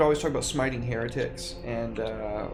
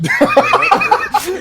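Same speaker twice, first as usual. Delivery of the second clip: squealing with laughter; laughing; 14 dB louder than usual. Real, not acted. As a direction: crack up mid-line. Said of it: "breaks up laughing".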